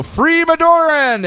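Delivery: high, slow rallying cry; mock battle cry. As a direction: shout it like fanatic soldiers.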